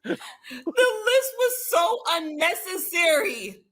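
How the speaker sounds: high, slow, laughing through the words; laughing disbelief. Real, not acted. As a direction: crack up while judging her. Laughing at the show's drama.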